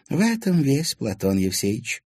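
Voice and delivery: slow, sighing resignation; old lady sighing over a stubborn man. A narrator's reading. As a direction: let it out with a sigh. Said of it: "вздохнула".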